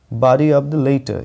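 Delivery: "of" said slowly as ov; careful pronunciation. Shown as a demonstration. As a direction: stretch out "body of the letter", "of" as ov. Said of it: "ये ओएफ़ में ऑफ नहीं पढ़ रहा".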